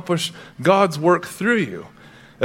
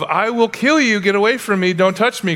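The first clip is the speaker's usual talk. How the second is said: higher-pitched mild mock fury; retold screaming cry; 6 semitones higher than usual. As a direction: imitate a child's angry cry without screaming. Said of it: "started swinging and screaming".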